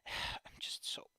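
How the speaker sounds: slow, whispered sigh; tired and done. Real, not acted. Streamer out of energy for today, spent. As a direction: trail off under the breath, spent. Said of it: "angry and tired and done".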